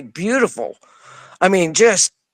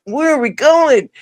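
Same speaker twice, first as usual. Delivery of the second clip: bewildered question; 8 semitones higher than usual. Retold or imitated. Floating up, bewildered.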